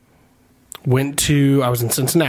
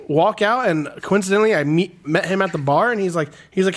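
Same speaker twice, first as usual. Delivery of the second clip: ordinary delivery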